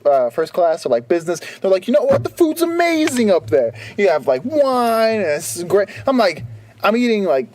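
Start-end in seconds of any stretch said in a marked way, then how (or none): 1.9-5.9 mock gushing. Quoting people bragging about first class.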